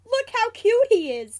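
high, very loud gushing; excited cry. A narrator's reading. James defends keeping the cub, crying out. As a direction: exclaim in adoring protest. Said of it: "James cries".

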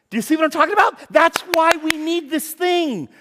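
rising preacher's cry; preaching peak. Real, not acted. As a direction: preach it out at full force.

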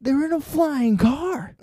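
"They're in a flying car" high and slow; high disbelief. Acted. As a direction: squeak it out in disbelief.

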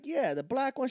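mildly excited man; eager guy. Retold or imitated. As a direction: sound hooked already. Joke, guy voiced eagerly.

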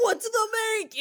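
high mock old man yell cracking into a laugh; laughing grandpa yell; hammed-up grandpa yell. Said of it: "grandpa yells".

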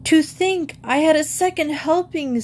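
high-pitched moan of regret; queasy, sorry-for-himself complaint; dismayed lament. Acted. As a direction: bemoan it, pitch held high. Groaning after eating snails.

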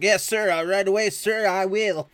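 high, loud, over-keen obedience; hammed-up yes-sir voice; eager recruit. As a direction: answer the chief with bursting eagerness. Eager recruit obeys the chief.

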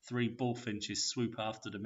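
ordinary delivery

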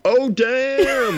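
amused startled exclamation; impressed oh damn. Retold or imitated. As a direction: react like you just saw a roast. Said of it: "I audibly let out an".

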